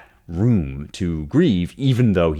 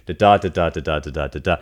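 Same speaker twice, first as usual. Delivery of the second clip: da da alternating weak and strong, da DA da DA; iambic rhythm tapped out; faster than usual. Shown as a demonstration. Teacher demonstrates iambic pentameter beats, rhythmic da da. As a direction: beat out the iambs evenly on da. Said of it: "iambic pentameter".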